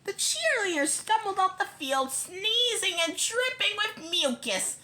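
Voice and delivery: usual speaking voice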